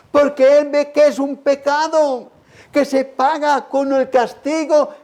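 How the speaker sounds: high, driving sermon delivery; impassioned preaching. Acted. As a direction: pour it out urgently from the pulpit. Preaching at full force.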